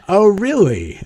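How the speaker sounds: high-pitched mock-innocent reaction; put-on "news to me" surprise; feigned surprise. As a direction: play surprised, pitch jumping up.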